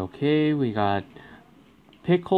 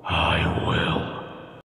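grim threat; 7 semitones lower, 4 dB quieter than usual. Pretend. Protecting brother threat.

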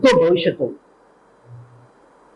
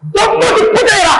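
screaming, much higher and louder; furious shout; 5 dB louder than usual. Acted. Auditioner picks a fight, screaming who dares. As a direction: roar a challenge at the crowd. Same challenge.

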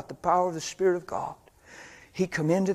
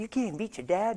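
higher-pitched mock taunt; imitating kids' taunts; 6 semitones higher than usual. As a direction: tease him like a mocking friend. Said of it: "they'd mock him and say".

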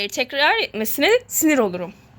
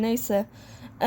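ordinary delivery

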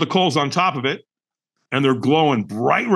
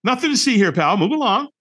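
high-pitched, overeager "all fine" cover-up; breezy denial; 10 semitones higher than usual. Retold or imitated. Man lying to a stranger that he's okay, high-pitched breezy denial. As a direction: insist cheerily, much too high, that nothing is wrong. Acting out a quick cover-up lie.

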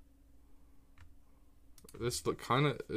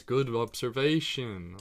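normal talking voice

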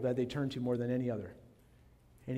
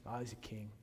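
mocking sneer; 6 semitones lower, 14 dB quieter than usual. Soldiers mocking Jesus.

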